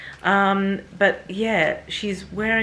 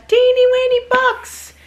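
put-on very high, loud baby squeal; teeny-weeny cutesy voice; 10 dB louder than usual. Vlogger teasing the tiny box to viewers, high cutesy squeal. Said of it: "I was using that voice".

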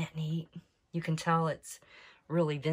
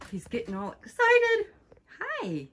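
much higher, louder sing-song coo; cooing to bird; 5 dB louder than usual. Real, not acted. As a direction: croon at the bird to calm it. Greeting the agitated buzzard.

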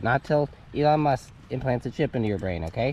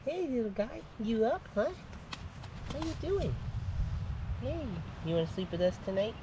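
softly pitched-up, tender pet voice; cooing to pet; 10 semitones higher, 7 dB quieter than usual. Real, not acted. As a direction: greet a little animal gently. Cooing to the baby goat.

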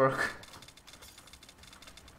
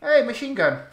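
excited; 8 semitones higher, 5 dB louder than usual. Real, not acted. Finds a weapon.

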